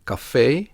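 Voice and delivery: "café" ending in a long E, as "ay". Shown as a demonstration. Teacher gives French loanword example, long E ending "café". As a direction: stress the long final E of "café". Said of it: "pronounced as a long E".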